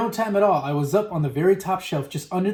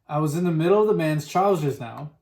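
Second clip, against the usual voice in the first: usual speaking voice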